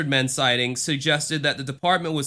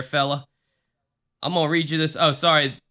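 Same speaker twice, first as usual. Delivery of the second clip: ordinary talk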